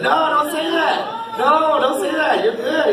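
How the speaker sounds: high, slow pleading reassurance; reassuring. Retold or imitated. Coach reassuring his player, slow and high. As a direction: talk a kid out of doubting himself.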